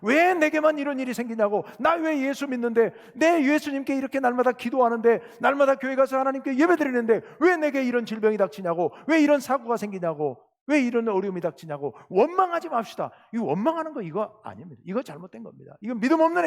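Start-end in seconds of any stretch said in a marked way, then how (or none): 0.0-12.0 high, insistent acted grievance; mock-aggrieved protest in a raised voice; resentful complaint. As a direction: pile up the whys like a bitter believer. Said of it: "예수님을 원망하는".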